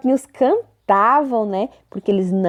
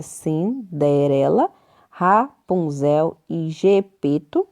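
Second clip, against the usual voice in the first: slow, split "Cinderela", "Rapunzel" and "Gepeto"; broken into syllables; 4 semitones lower, much slower than usual. Shown as a demonstration. Teacher showing how the words separate, "Cinderela", "Rapunzel", "Gepeto" in pieces. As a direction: pause inside each name, "Cinderela", "Rapunzel", "Gepeto". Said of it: "como é que se separa a palavra".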